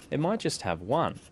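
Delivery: ordinary talk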